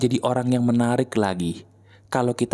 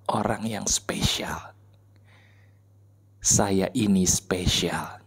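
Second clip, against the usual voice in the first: slow, low, hushed mirror affirmations with long pauses; guided self-affirmation; 8 semitones lower, slower than usual. Shown as a demonstration. Memandu afirmasi diri di depan cermin. (afirmasi diri yang pelan, rendah dan lembut)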